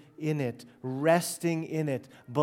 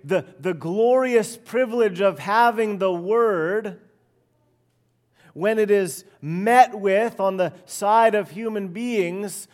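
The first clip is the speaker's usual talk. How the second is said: fervent preaching; 4 semitones higher, 4 dB louder than usual. Acted. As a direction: hammer home God's faithfulness. Preaching at full force.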